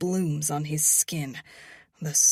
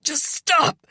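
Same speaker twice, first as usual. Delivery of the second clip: wincing; 11 semitones higher, 9 dB louder, slower than usual. A narrator's reading. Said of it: "he winces".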